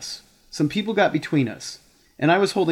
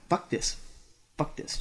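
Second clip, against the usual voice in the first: muttered cursing; 12 dB quieter than usual. Retold or imitated. Angry coworker muttering curses to himself, softly. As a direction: mutter it under the breath. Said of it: "he just starts going".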